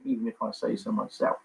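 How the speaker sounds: ordinary talk, nothing marked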